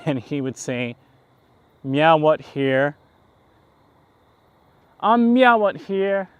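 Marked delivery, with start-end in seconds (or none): 1.8-2.9 loud, slow mock streamer voice, drawn out; Action Kid impression. Vlogger imitating his streamer buddy, loud and slow. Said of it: "That's probably something he would say". 5.0-6.3 higher and loud, slow mock streamer voice; Action Kid impression. Vlogger mimicking his buddy's catchphrase, high and loud. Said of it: "That's probably something he would say".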